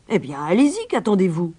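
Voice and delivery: a touch of impatient growl, loud; mayor grumbling. Acted. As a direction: growl at the stranger to get on with it. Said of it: "grogna le maire". (grognement impatient du maire)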